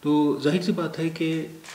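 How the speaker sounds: usual speaking voice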